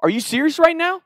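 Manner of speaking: high, exasperated disbelief; retold outrage at the old dean, voice jumping up; losing it. Student to dean, outraged disbelief. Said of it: "I'll, I'll lose it".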